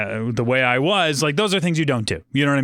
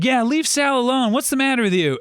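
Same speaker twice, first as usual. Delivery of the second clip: much higher imitated protest; indignant defending; 10 semitones higher than usual. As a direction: play kids jumping to someone's defence, high-pitched. Kids in booth defend Sal.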